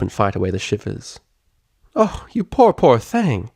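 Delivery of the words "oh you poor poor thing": Southern pity, high and tender; sympathetic. A narrator's reading. Applejack pitying Octavia, Southern sympathy. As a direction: soothe a poor little foal. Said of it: "with a sympathetic smile".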